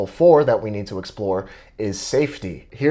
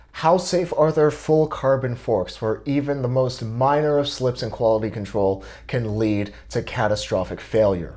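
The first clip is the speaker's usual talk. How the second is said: ordinary talk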